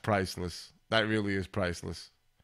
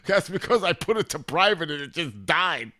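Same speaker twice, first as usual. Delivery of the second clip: laughing; 6 semitones higher, 5 dB louder than usual. Real, not acted. Laughing at the stream dying.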